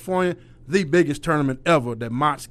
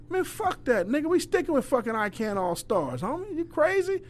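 higher, scoffing brush-off; dismissive scoff; 9 semitones higher, 4 dB quieter than usual. Retold or imitated. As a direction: wave the complaint away with a scoff. Coach shoots down kids' complaint.

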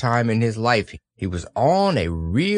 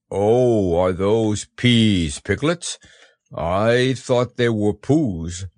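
slow, growly bear voice; puzzled bear; 3 dB louder, much slower than usual. A narrator's reading. Pooh hears the message read aloud, puzzled bear.

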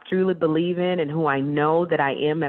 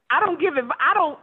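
laughing through the words, higher; real laugh while insisting; 9 semitones higher than usual. Real, not acted. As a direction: giggle out the refusal. Laughs at own joke.

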